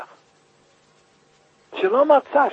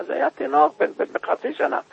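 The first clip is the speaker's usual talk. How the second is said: usual speaking voice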